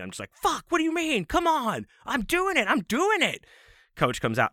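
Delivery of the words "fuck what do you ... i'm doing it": very high, exasperated mock yell; visibly mad. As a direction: imitate a kid's furious, high-pitched protest. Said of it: "like, really visibly mad".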